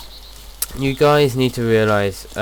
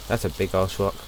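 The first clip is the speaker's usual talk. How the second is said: ordinary talk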